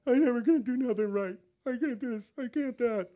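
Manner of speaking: hammed-up loser's lament; mock defeated voice. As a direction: imitate someone who's given up on himself. Voicing a self-defeating believer.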